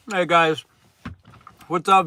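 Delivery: usual speaking voice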